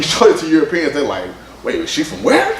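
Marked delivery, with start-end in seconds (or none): 1.6-2.5 high-pitched incredulous question; mock disbelief. Europeans reacting to the early Philippines picture, in mock disbelief. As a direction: imitate someone taken aback, pitched way up.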